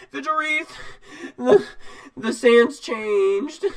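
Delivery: put-on high, winded young knight, halting between words; out of breath. Small knight reaching Vigilwreath after a long run, out of breath. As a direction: gasp it out loudly in a high boyish voice. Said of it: "he's out of breath".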